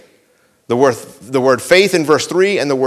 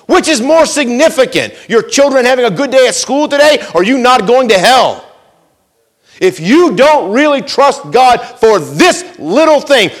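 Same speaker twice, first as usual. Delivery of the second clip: higher, louder preaching at full force; 8 semitones higher, 5 dB louder than usual. Acted. Pastor confronts the church about trusting God, full-force preaching. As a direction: drive it home to the congregation, pulpit-pounding.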